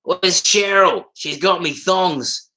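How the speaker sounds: high, drawn-out broad Aussie accent; classic Aussie. Shown as a demonstration. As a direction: stretch it out in hammed-up Aussie. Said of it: "that kind of classic Aussie shit".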